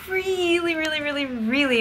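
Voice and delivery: high, stretched repeated really; excited emphasis. Real, not acted. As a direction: pile up the excitement. Building up exciting announcement.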